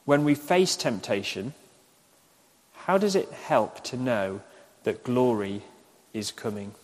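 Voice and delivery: measured question with long pauses; slow dictation. Shown as a demonstration. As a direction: repeat it slowly for note-takers. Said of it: "I'll say them slowly".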